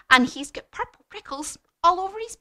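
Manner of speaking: high, loud mouse voice; mouse describing a monster. Acted. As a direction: build up the monster for children. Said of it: "And he said".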